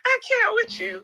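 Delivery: high squeal of disbelief; laughing disbelief. Real, not acted. High-pitched laughing reaction.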